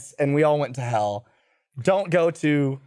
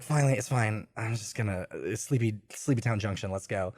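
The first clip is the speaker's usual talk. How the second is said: low, soft, drowsy self-talk; mumbling to herself while settling into bed; sleepy mumble; 6 semitones lower, 8 dB quieter than usual. Retold or imitated. Tired traveler talking herself to sleep, drowsy mumble.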